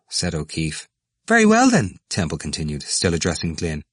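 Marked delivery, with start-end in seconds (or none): 1.3-2.0 high, loud, eager voice; excited young man. Acted. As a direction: push it out high. Temple's high voice.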